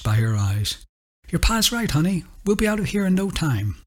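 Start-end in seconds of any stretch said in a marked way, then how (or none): 1.3-3.8 higher, gently voiced Bonnie; soothing the girl. A narrator's reading. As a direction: soften it for a child. Bonnie comforts the little girl.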